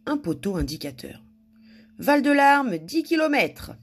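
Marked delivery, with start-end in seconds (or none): 2.0-3.7 higher, louder read-out of a road sign; signpost reading. A narrator's reading. Madame Bonheur spots a road sign, read out high.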